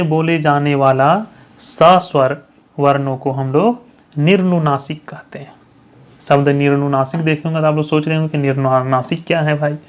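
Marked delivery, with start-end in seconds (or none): 5.1-5.5 whisper. Real, not acted. Teacher drops into whisper.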